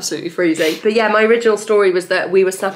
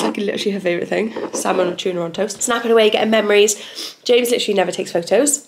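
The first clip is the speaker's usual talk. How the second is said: usual speaking voice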